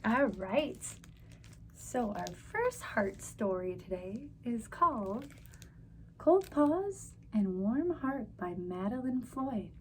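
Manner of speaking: ordinary delivery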